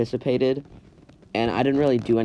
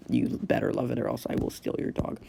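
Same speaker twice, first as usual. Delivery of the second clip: mock whispered threat; 3 semitones lower, 6 dB quieter than usual. Joking threat about his own camp.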